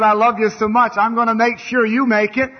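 voice of the Lord. Retold or imitated. The Lord speaking to his common folks, booming and high. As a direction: proclaim it like God speaking from above. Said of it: "And the Lord said".

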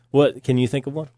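usual speaking voice

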